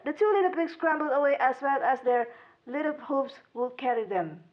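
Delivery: plain reading voice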